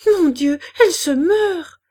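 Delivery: loud, high cry of horror; panicked cry. A narrator's reading. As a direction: cry out as a mother seeing her child dying. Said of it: "cria-t-elle". (cri d'effroi)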